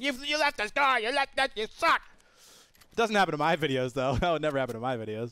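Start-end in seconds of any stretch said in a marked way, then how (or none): 0.0-2.0 high put-on nagging voice; mocking commenters. Gamer imitating viewers who comment on his bad looting, high mocking voice. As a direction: imitate complainers far up in pitch. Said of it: "everyone comments on your videos how you suck".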